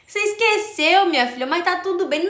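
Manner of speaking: high, loud imitation of mum; imitated mother. Mother reassures her daughter after the show, high-pitched imitation. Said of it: "Engraçado foi a minha mãe".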